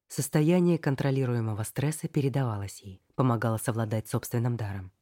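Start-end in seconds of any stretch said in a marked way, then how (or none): none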